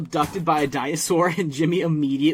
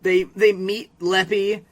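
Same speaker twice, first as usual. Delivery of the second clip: normal talking voice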